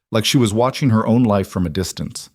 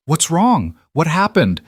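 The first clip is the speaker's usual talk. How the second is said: higher, urgent concern; worried; 8 semitones higher, 4 dB louder than usual. Acted. Woman questions the crying girl, worried. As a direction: ask anxiously, pitch lifted.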